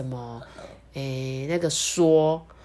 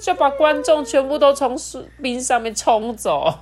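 excited; 7 semitones higher, 3 dB louder than usual. A narrator's reading. Wave sweeps audience away.

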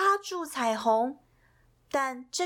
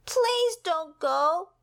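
slow, high, drawn-out plea; pleading; 7 semitones higher, slower than usual. Acted. Pip pleads again with the rainbow, slow and high. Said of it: "begged Pip".

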